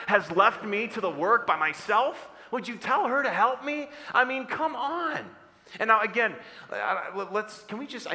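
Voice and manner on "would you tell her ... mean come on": high, exasperated plea; exasperated complaint. Acted. Martha asks Jesus for help, exasperated. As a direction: complain like you've been left with all the work.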